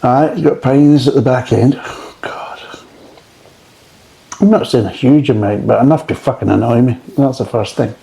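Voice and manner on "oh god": real breathy whisper of dismay; barely audible, appalled whisper. Real, not acted. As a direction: whisper it under your breath, put off by the taste. Recoiling from the beer's taste.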